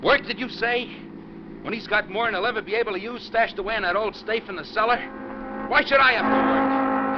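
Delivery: loud, high-pitched furious rant; ranting and raving. Acted. Al ranting at his passenger in the car, shouting angrily. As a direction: rage at the unfairness, voice climbing. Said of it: "with all your ranting and raving".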